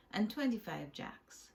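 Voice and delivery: plain reading voice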